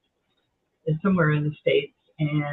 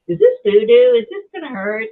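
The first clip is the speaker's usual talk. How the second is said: worried client; 6 semitones higher, 9 dB louder than usual. Retold or imitated. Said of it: "humans I talk to say".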